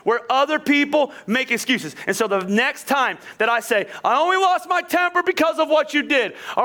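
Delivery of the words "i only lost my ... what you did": higher-pitched self-justifying protest; mock blame-shifting, pitched up; defensive excuse. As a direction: protest that it's their fault.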